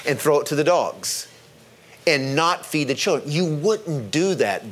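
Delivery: ordinary talk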